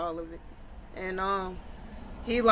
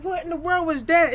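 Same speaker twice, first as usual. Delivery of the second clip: higher, louder startled question; 8 semitones higher, 5 dB louder than usual. Acted. Alexis hearing the boom from the next room, startled and louder. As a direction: jump at the sudden boom.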